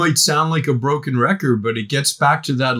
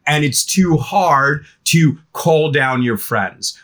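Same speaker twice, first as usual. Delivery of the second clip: normal talking voice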